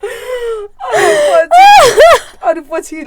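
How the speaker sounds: crying with laughter, high and loud; helpless laughter. Real, not acted. Friends cracking up over the bar story, crying with laughter. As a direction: laugh until you can barely speak.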